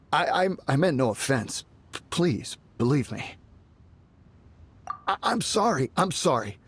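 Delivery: stuttering, pleading apology; nervous stammer. Acted. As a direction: stumble over every word, scared. Guard stammering apologies, begging.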